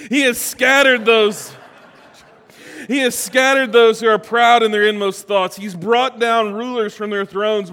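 loud, high-pitched preacher reading scripture; impassioned scripture reading. Acted. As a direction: proclaim the verses with force, easing slightly. Reads scripture with rising force.

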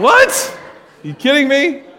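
jumps up high in pretend shock; a hammed-up you-kidding-me; mock disbelief. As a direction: act stunned by the bacon ban. Mock outrage over bacon caption.

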